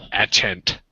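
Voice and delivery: "accent" said as "atchent"; misspelt "accent". Shown as a demonstration. Host orders Matt using Walter's typo, "accent" as "atchent". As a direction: put a CH inside "accent". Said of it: "C's not even close to H".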